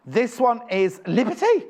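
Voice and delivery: slow, high, drawn-out Liberty with a lifted end; slightly posh-sounding announcement of Liberty; odd sing-song. Real, not acted. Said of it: "I don't know why I said it like that".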